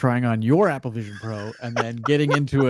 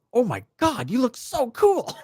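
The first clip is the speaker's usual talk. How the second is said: much higher, gushing impressed voice; gushing coworkers; 12 semitones higher than usual. Retold or imitated. [name] quoting coworkers in meetings, as a gushing high voice. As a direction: gush like awestruck colleagues. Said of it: "everybody's like".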